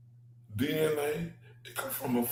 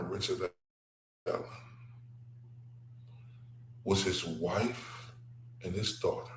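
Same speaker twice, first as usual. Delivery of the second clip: quiet solemn; 8 semitones lower, 11 dB quieter than usual. Real, not acted. Telling viewers about Allen's family, quietly grave. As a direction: hush it like a grave secret.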